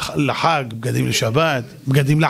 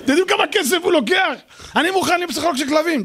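incredulous outburst; 9 semitones higher than usual. Real, not acted. Incredulous at dog psychologists.

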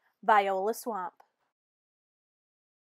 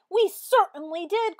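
much higher, loud, joyful cry; cried out; 9 semitones higher, 8 dB louder than usual. A narrator's reading. Children welcoming their teacher back, joyful cry. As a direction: shout it out with joy. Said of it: "cried all the kids".

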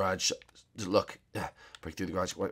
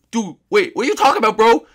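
a sleepy bro, pitched up and loud; high-pitched dude voice; 12 semitones higher, 9 dB louder than usual. Acted. Woken friend greeting Sakawaka in a high, loud dude voice.

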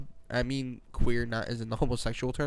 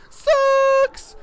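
very high, loud, stretched yell; dubbed-over shout; 11 dB louder than usual. Retold or imitated. Friend's voice interrupting the intro, high shout. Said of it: "enter my voice that says".

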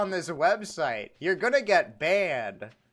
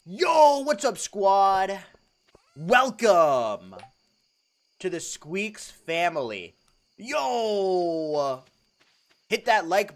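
loud, high hype greeting; hyped stream intro; 3 semitones higher, 6 dB louder, slower than usual. Pretend. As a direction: burst in like a streamer opening the show. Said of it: "streamer intro greeting".

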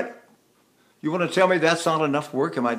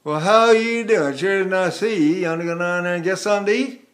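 put-on Georgia accent; 2 semitones higher than usual. Voice teacher imitating folk in Atlanta, Southern accent. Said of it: "You go to Atlanta".

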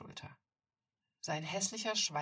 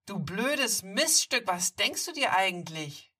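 furious bully shout; 5 semitones higher, 13 dB louder than usual. Acted. Bully explodes at Maya.

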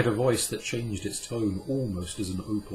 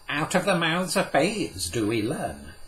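Cadmiel, a touch amused; 8 semitones higher, 5 dB louder than usual. Acted. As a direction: play the learned old man, gently amused. Cadmiel's voice in the story.

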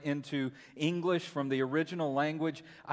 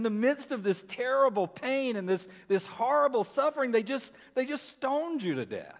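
higher, pleading wonder; awed questions to Paul; 6 semitones higher than usual. Real, not acted. Preacher asking Paul how he endured, pitched up in awe. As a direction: ask Paul in disbelief, voice lifted.

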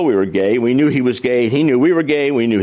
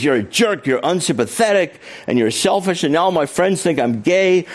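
higher-pitched complaint, no real shout; mildly indignant mock tirade; retold shouting; 7 semitones higher than usual. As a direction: list the insults in a raised pitch. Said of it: "Dick started shouting back".

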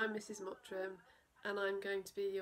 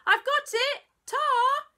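very high, loud call across the garden; farmer's cheery yell; 16 dB louder, slower than usual. Acted. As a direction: shout thanks from the back. Farmer calls out.

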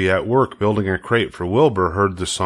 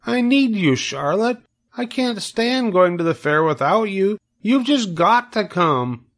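begging piglet; 12 semitones higher than usual. Acted. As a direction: beg like a scared young pig. Wilbur voiced, pleading.

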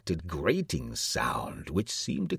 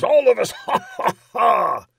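loud, high joke breaking into laughter; laughing king; 11 dB louder than usual. A narrator's reading. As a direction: burst into hearty ha-ha at your own joke. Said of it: "his laugh was chorused".

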